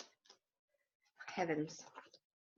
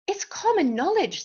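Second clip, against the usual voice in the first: high British know-it-all duck; British accent; 9 semitones higher than usual. A narrator's reading. Duck corrects Dodsworth on the bridge, British accent. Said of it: "He spoke with a British accent".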